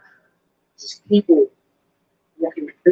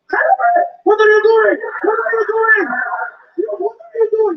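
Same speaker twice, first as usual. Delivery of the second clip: mock alarmed shout; 13 semitones higher than usual. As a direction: shriek it like a panicked guard. Middle East guards shouting at woman.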